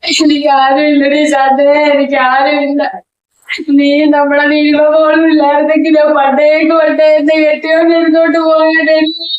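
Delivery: high, loud, wailing complaint; loud wailing lament. Acted. Lonely woman wails her sorrows to Shanta, loud and high. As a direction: cry out your misery to your friend.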